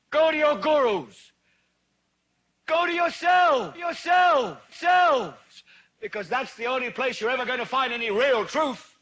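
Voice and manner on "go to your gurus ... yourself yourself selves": high, rising shouts with drawn-out final words; urgent, ringing exhortation; exhorting cry. Acted. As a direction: cry it out like a revival preacher. Tirade climax urging the audience.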